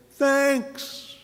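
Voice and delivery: high, bright Thanks; everyone's thanks. A narrator's reading. All the friends thanking together, high bright Thanks. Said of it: "refrain line".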